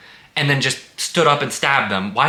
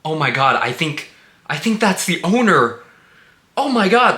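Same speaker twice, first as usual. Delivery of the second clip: rising, higher and louder panicky "oh my God"; mock panicked girl; 7 semitones higher, 4 dB louder than usual. Mocking the heroine's line in the review, rising mock panic. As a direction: ham up a horror heroine's dawning shock. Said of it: "the girl has to go".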